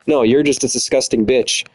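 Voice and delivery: ordinary talk, nothing marked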